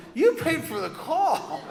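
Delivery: very high, loud, slow shriek of disbelief; mother can't believe her ears. Retold or imitated. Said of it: "she shrieked in disbelief".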